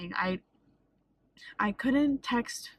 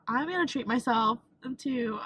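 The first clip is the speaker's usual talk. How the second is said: ordinary talk, nothing marked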